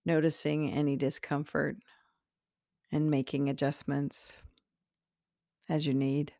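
guided meditation. Acted. Meditation host leading listeners into their heart center, slowly and calmly. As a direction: guide them gently inward, unhurried and spacious.

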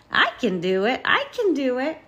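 rising, keen little bulldozer; eager cry. A narrator's reading. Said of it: "cried Bulldozer".

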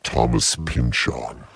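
very deep and slow; drawn out very low. Pretend.

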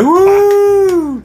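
high cheering woo. Acted. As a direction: whoop like a crowd. Imitating sitcom audience cheer.

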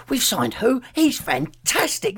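high, slow, thrilled fan voice; excited. Retold or imitated. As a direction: gush like a delighted fan. Fans once excited about signings.

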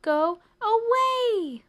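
slow, high, loud wail; shouted plea. A narrator's reading. Sleepless mouse shouts at the crickets, desperate. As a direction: hammer out each word, climbing to the end. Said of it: "shouted the mouse".

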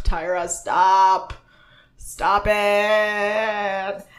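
very slow, stretched-out plea; mock exasperated. Host to Tyra, too proud of her teaching, mock exasperated. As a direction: drag out each word in mock annoyance.

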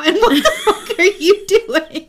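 high, loud, slow laughing question; laughing disbelief. Real, not acted. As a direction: ask it while cracking up. Laughing at cohost's odd pose.

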